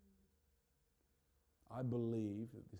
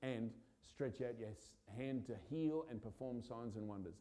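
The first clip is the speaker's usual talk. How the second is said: normal talking voice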